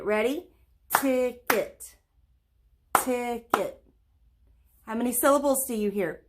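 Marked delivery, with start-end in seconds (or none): 1.0-2.0 ticket said in two beats, TICK-ET; clapped syllables. Shown as a demonstration. Teacher to kids, clapping out the syllables. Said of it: "We're gonna say it and clap it". 3.0-3.8 ticket said in two beats, TICK-ET; clapped syllables. Shown as a demonstration. Teacher to kids, clapping out the syllables. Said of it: "We're gonna say it and clap it".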